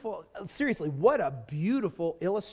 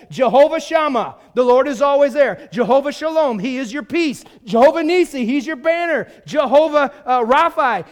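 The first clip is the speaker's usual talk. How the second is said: raised, driving preacher's delivery; full-force preaching; 5 semitones higher, 8 dB louder than usual. Real, not acted. Preacher proclaiming God's names to congregation, full-force preaching. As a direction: proclaim it loud and high to the congregation.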